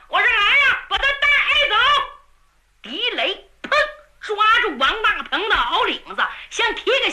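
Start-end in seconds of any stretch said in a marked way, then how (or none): 0.3-1.7 high-pitched shouted command; raised, ringing order; calling out orders. A narrator's reading. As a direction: summon the guards sharply. Commander calls to his men.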